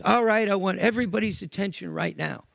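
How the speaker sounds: loud angry speech to the crowd; shouting. Retold or imitated. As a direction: yell it at the whole tent.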